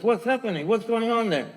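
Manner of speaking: high-pitched, sharp acted questioning; officer's demand. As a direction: imitate an officer demanding to know what's going on. Officer interrupts the commotion, retold.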